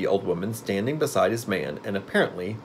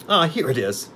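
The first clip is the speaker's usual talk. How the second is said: higher, joyful exclamation; joyful cry; 7 semitones higher, 3 dB louder than usual. A narrator's reading. Witch finding her powder in the basket, joyful. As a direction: cry out, delighted at the find. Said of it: "she cried joyfully".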